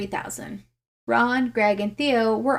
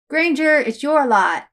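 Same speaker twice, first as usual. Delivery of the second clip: calling across the flat, raised voice; 4 semitones higher, 6 dB louder than usual. Acted. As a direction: call it out to the room. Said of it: "he called out".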